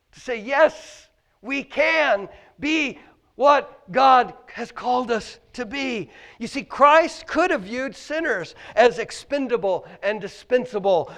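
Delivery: loud, ringing pulpit questions; preaching at full force. Acted. Pastor challenging the congregation, loud preaching. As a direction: hammer each question home to the church.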